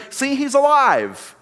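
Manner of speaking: high, drawn-out gullible exclamation; mock eager discovery; triumphant. Gullible people's sudden triumphant cry.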